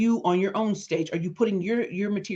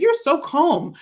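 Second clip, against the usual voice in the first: friend amazed; 9 semitones higher, 5 dB louder, slower than usual. Retold or imitated. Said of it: "she said".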